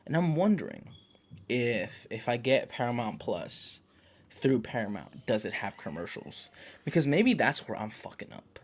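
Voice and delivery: ordinary talk, nothing marked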